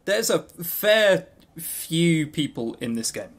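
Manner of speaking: ordinary talk, nothing marked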